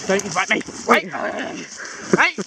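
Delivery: usual speaking voice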